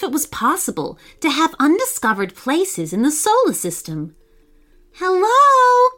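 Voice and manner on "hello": high, loud call across open ground. Acted. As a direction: shout hello into the unknown. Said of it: "called out Trent".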